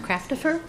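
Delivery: "Craftifer" stressed on the first syllable; "Craftifer" with a light, unstressed middle syllable; own surname pronounced. Shown as a demonstration. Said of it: "My last name is pronounced".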